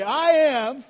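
loud and high call-out; preacher's rallying shout; preached shout. Real, not acted. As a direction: shout it to the rafters. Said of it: "Come on, somebody".

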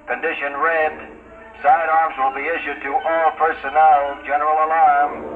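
loudspeaker announcement. Acted. Ship PA general alarm.

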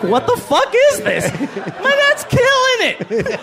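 loud, high comic outburst of disbelief; gleeful disbelief. Acted. Comedian marvelling at his dad's moments, high incredulous shout. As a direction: shout it in mock amazement, stretching the end.

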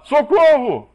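loud, high desperate yell; drowning man's shout for help; cry for help. Acted. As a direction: scream it like you're drowning. Drowning person cries for help.

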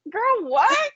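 put-on high laughing "girl, what"; squeaky nervous amused question; imitating Sutton. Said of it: "nervous laughter, sounds like".

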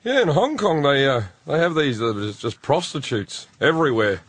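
imitating dad's offhand, slightly slowed tone; forced casual. Imitating dad telling his son about Hong Kong, forced casual. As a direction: mimic dad acting like it's normal. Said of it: "he's trying to talk like it's normal".

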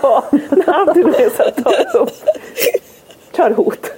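words through real laughter; can't stop laughing mid-story. Real, not acted. Laughing while retelling.

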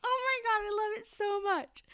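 high, loud, slowed gush; gushing excitement. Real, not acted. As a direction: gush about the scene you love. Gushing about a favourite scene.

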